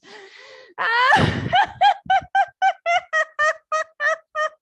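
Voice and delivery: loud, high, sustained belly laughing. Shown as a demonstration. Teacher leading a laughter yoga belly laugh, full out. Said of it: "One more time".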